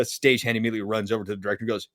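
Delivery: ordinary delivery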